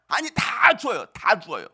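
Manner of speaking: usual speaking voice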